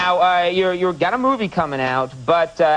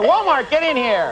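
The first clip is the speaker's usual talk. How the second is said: calling out; 8 semitones higher than usual. Pretend. Calling a child in.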